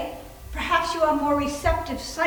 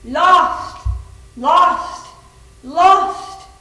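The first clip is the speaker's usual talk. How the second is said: louder, higher cries of lost, rising each time; repeated lost; 4 semitones higher, 9 dB louder, much slower than usual. Acted. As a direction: wail it like a lost spirit. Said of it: "One word over and over again".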